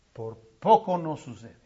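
low and soft, almost to himself; awed near whisper; hushed. Acted. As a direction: let it fall to a hush. God's hushed near-miss line.

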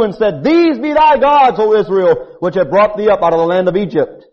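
high, loud thundering scripture; upset God rebuking his people; preaching at full force. Acted. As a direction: bellow God's wrath from the pulpit. Rereads God's angry words louder.

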